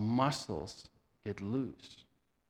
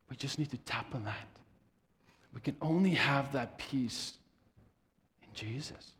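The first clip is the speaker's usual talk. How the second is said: guided visualization. Acted.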